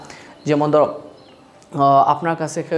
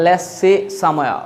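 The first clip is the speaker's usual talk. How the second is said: "Let's see somewhere" stretched slowly, the s of "Let's" held; drawn-out teacher demo of "Let's see somewhere"; much slower than usual. Shown as a demonstration. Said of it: "আমি যেরকম বলি আপনিও বলেন".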